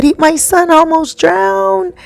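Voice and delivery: high-pitched imitation of a distraught mother; retold crying. Mother whose son almost drowned, retold crying. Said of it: "she was crying and crying".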